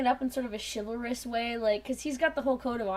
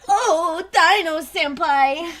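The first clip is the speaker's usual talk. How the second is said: loud, slow, swooning call; drawn-out adoring squeal at her senpai; swooning fangirl squeal; 5 semitones higher, 14 dB louder, much slower than usual. Pretend.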